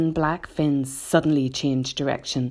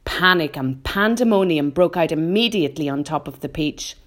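usual speaking voice

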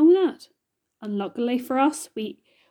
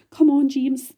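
raised, insistent coaxing; 4 semitones higher, 7 dB louder than usual. A narrator's reading. Ladybird coaxing a hesitant James, raised coaxing voice. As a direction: urge him along, louder and higher. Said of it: "coaxing him".

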